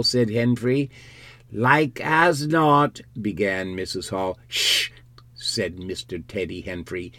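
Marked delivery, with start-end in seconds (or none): 1.5-3.0 high, drawn-out country wife; lightly voiced sceptical villager; dismissive wife. Missus Hall begins, dismissive. 4.5-4.9 long unvoiced shush; sharp breathy shushing; hushing shh. Henfrey hushes Missus Hall.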